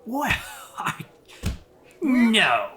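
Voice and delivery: put-on high, startled old man's no; old elf surprised. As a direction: gasp out disbelief like a stunned old man. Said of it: "It's like".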